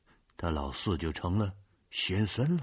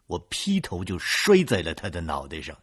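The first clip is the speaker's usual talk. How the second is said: ordinary delivery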